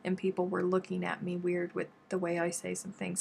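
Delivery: ordinary talk, nothing marked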